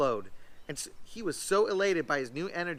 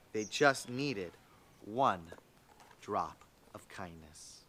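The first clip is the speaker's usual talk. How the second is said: slow and much softer, drawing out the last words; soft, slow ending; 9 semitones lower, 6 dB quieter, much slower than usual. A narrator's reading. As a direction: let the story settle to a close. Story's final line.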